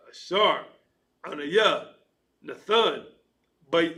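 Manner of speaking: normal talking voice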